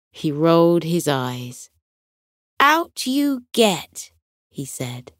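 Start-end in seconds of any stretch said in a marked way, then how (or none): 2.6-4.1 higher, slow mock-stern shooing; a lightly voiced panda telling his friends off; gentle scolding panda. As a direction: shoo the little ones out, half amused. Bao shoos dumplings from the sink.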